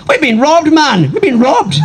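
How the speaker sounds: high-pitched, aggrieved Irish complaint; hammed-up high Irish protest; robbed Irishman. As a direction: wail it as the outraged victim.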